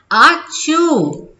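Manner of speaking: high, drawn-out mock "achoo"; acted sneeze. Teacher voicing the elephant with a cold, mock sneeze. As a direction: sneeze it out slowly, storybook style. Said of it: "उसने छींक मारी".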